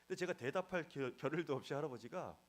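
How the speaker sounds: ordinary delivery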